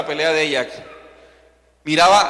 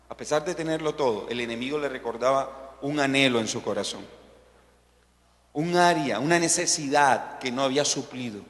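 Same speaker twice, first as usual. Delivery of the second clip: intimate preaching; 5 semitones lower, 8 dB quieter than usual. Acted. Preacher inviting the congregation to reflect, soft and intimate. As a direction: lean in and speak gently to each listener. Quiet reflective altar appeal.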